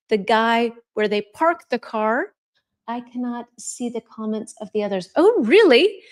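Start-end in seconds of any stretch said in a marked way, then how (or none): 5.2-6.0 real surprise, higher and louder; genuinely taken aback by the news; surprised. Real, not acted. Teacher reacts to a viewer's missing comments, surprised.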